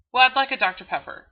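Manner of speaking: lightly voiced friend rattling off an order; ordering a soda. Acted Texas soda-run chat.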